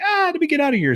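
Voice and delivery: high panicked yelp, played for laughs; hammed-up frightened cry; mock panic. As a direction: squeal it out like a scared girl in a horror film. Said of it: "she's like".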